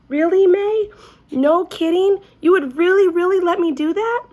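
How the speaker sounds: high, loud disbelieving squeal; can't believe her luck, gasping it out; very shocked. A narrator's reading. Junie asking May if she can really burp the toy, shocked. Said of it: "very shocked".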